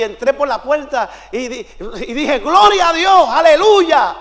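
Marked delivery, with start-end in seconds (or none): 2.4-4.2 loud, high greeting of praise; shouted praise. Retold or imitated. Visitor enters a church praising God, loud exuberant shout. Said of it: "no grite, Dios no está sordo".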